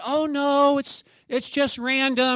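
high, loud, brushing it off; auditor's breezy denial. Retold or imitated. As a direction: play the auditor waving it away. Auditor denies targeting.

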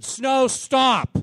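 loud, high command to the weather; commanding shout. Retold or imitated. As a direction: order the snow to stop at full voice. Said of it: "I just said".

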